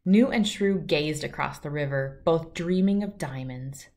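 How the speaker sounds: usual speaking voice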